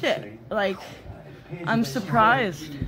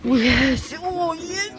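normal talking voice

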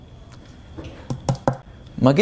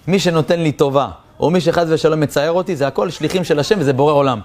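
ordinary talk

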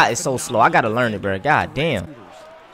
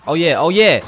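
higher, louder hype; excited cheer; 4 semitones higher, 7 dB louder than usual. Real, not acted. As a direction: cheer the made shot. Builds celebration over repeats.

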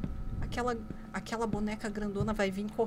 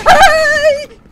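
very loud, piercing shriek; startled scream; 27 dB louder than usual. Real, not acted. Player frightened by the child, startled scream. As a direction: scream high in sudden fright. Jump scare in horror game.

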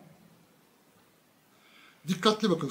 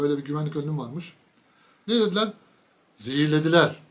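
ordinary talk, nothing marked

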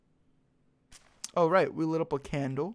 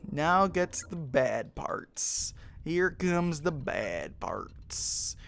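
slow dread; much slower than usual. Real, not acted. Dreading the sad scene.

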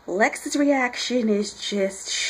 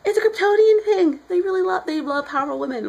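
excited; 9 semitones higher, 5 dB louder than usual. Real, not acted. Excited fan gushing.

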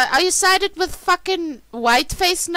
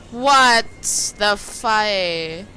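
usual speaking voice

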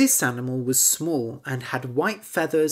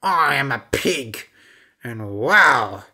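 louder, odd pig voice; 6 dB louder than usual. Acted. Pig answering the frog, louder. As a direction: speak as a pig.

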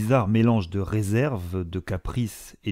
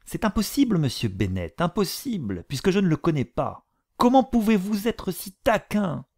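high, exasperated protest; exasperated mother; 10 semitones higher than usual. A narrator's reading. The mother protests to her teasing husband, high. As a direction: plead against his teasing, voice up high.

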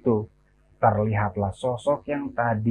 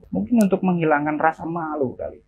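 usual speaking voice

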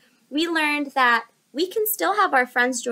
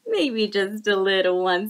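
octopus voice; 5 semitones lower than usual. Acted. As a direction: lower hesitant octopus. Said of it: "said the octopus".

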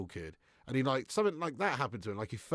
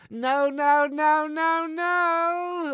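loud, high, drawn-out cartoon wail; Cleveland; 11 dB louder, much slower than usual. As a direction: cry out each "no" in a hammed-up panic. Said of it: "just about to do Cleveland".

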